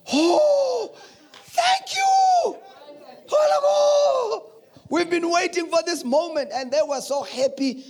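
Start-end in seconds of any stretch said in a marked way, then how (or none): 0.1-2.0 high mock relief cry; relieved congregation. Congregation greets the pastor's new look, high and relieved. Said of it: "They were like". 2.1-6.5 high, loud, laughing cheer; excited congregation. Retold or imitated. Congregation finally sees the comfortable pastor, cheering loudly. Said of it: "They were like".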